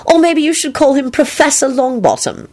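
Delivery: high-pitched, spiteful jab; nasty. A narrator's reading. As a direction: needle him with mock deference. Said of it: "she added nastily".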